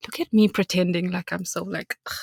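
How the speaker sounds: usual speaking voice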